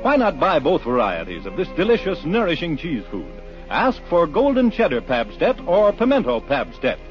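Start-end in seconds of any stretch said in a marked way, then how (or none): none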